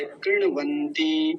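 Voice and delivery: कृणवंती slow, ण straight into व without vowel; half ण. Shown as a demonstration. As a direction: stretch कृणवंती, keeping ण half. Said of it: "णकार जो है आधा है".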